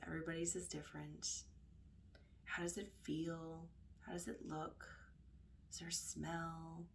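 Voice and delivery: hushed, unhurried guiding voice; guided meditation. Acted. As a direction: guide them gently inward, leaving space between phrases.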